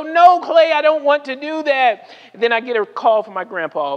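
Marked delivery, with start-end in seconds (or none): 0.0-2.1 high, loud mock protest; retold exasperated no; protesting refusal. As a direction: push back hard, voice pitched up. Acted protest to friend.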